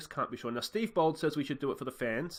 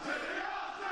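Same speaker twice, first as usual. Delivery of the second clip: laugh; 5 dB quieter than usual. Real, not acted. Laughing after goal shout.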